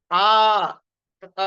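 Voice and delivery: "ఆ" with the throat fully open; open vowel. Shown as a demonstration. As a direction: open wide on "ఆ". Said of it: "మొత్తం గొంతంతా". ("ఆ" గొంతు పూర్తిగా తెరిచి)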